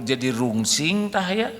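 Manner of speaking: ordinary talk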